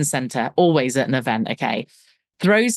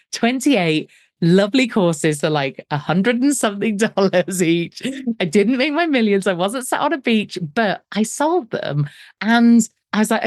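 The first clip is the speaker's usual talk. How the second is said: normal talking voice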